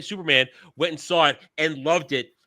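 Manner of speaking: ordinary talk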